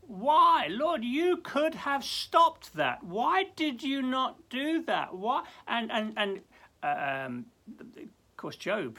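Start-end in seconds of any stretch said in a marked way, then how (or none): 0.1-5.4 high, loud, slow lament; pleading lament to God; anguished why. Pretend. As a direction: cry out the question in grief. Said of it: "and of course you will say".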